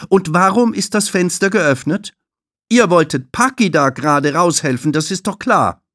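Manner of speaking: high, loud stern question; strict receptionist scolding; stern interrogation. Acted. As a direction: grill the children. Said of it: "fragt Tulile".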